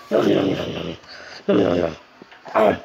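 low growling no no no; deep monster growl. Pretend. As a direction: drop into a deep beastly growl.

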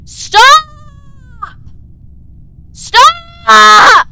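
high, loud shout of stop. Real, not acted. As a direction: yell at them to stop. Yelling at a player.

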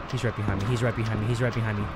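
low, quiet repeated warning; soft, low nervous murmur; hushed dread. Real, not acted. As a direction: keep it down, he's right on your heels. Hiding from pursuer behind him.

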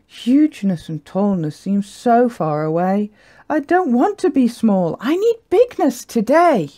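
rising from wistful to high, insistent complaint; yearning little yak. Acted. Little yak impatient to be big, rising protest. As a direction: build to a child-like protest. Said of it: "Gertie sighed a huge sigh".

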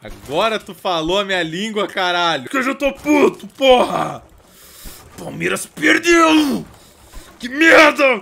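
high, loud raging shout; a fuming fan yelling as he smashes things; furious rant. Pretend. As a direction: explode at your team losing. Angry at Palmeiras losing.

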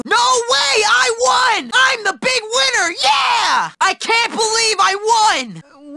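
high, elated shouting; jubilant victory cry. Acted. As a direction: celebrate a shock win. Celebrating an unexpected win.

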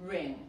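a low teaching "ring" that closes on ng; final G pronounced. Shown as a demonstration. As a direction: model "ring" with its final G kept. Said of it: "también la G se pronuncia".

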